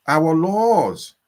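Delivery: ordinary talk, nothing marked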